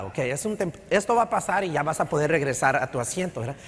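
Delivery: ordinary talk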